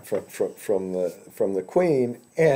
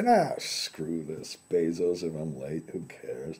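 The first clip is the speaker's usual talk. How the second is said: dismissive; 5 semitones lower, 7 dB quieter than usual. Pretend. Student shrugging off being late for Bezos, dismissive. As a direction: brush off the meeting lazily. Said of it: "Maybe you say".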